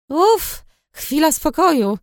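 high, loud sigh of relief on uff; finally a breather, let it out; relieved sigh. A narrator's reading. Busy bartender finally alone.

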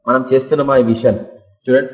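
usual speaking voice

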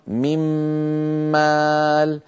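من مال بميم مشددة مع غنة; أدغم النون في الميم; إدغام بغنة. Shown as a demonstration. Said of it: "ومثاله عند النون". (ميم مشددة بغنة)

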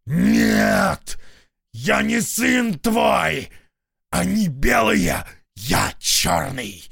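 loud high rasping fury; raging hoarse shout. Acted. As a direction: scream your hatred at God. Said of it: "прохрипел". (хриплый яростный крик)

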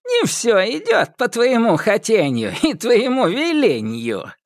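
high gloating witch voice; old witch mocking him. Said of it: "злорадствовала Яга". (злорадная старая ведьма)

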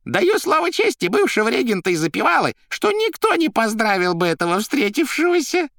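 very high, loud character voice; Koroviev squeaking. A narrator's reading. Koroviev warning Margarita off Azazello, high squeaky voice. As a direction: squeak on emphatically. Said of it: "пищал Коровьев".

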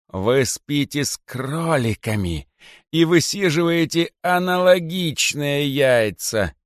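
slow, high reproach; snake king. A narrator's reading. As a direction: accuse them slowly. King retort. (царь укоряет медленно)

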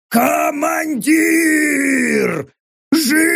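high, long-drawn joyful bellow; deafening orc roar. A narrator's reading. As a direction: roar each word out, stretched long. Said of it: "взорвалась оглушительным рёвом орка".

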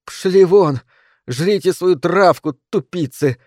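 high, loud yell at the sheep; furious narrated scream; angry shout. A narrator's reading. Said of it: "закричала она".

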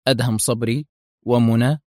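ordinary delivery

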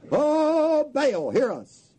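high cry then slow plea; hammed-up plea to an idol; mock wailing prayer. Prophets of Baal begging their god, hammed-up.

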